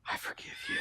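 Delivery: barely audible, voiceless whisper; whispered forgiveness. Retold or imitated. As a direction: imitate a dramatic little sister whispering. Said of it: "She, like, whispers it".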